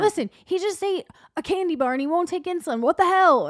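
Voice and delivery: high, heated venting; imitating a fed-up, high-pitched rant; retold exasperation. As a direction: complain in worked-up disbelief, ending loud. Exasperated mother's complaint, retold.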